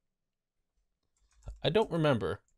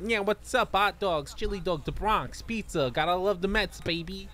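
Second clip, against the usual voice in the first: high, hammy Brooklyn tough guy; Brooklyn accent; 6 semitones higher than usual. Pretend. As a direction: lay on a cartoon Brooklyn accent. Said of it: "he's gotta be like".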